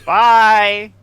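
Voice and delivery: high, loud, drawn-out goodbye; called-out farewell. Acted. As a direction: call after them as they leave. Calling after departing lizard folk.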